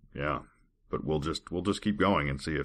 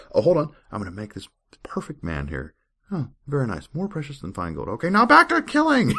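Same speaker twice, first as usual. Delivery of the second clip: lightly voiced God, breezy, higher, then shooting up for the last line; mock cheerful God; 11 semitones higher, 7 dB louder than usual. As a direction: switch to a chirpy, pleased god, then snap back. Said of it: "And then he's like".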